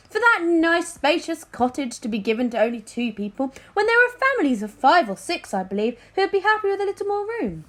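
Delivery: a king pressing his point, pitched high; high, pompous king, rushing the end; loud king. A narrator's reading. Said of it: "King Fred said loudly".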